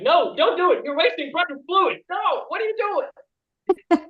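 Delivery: high, loud comic pleading; hammed-up panicked yelling at the screen; mock distressed outburst. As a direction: protest frantically, voice shooting way up. Acting out outburst at film.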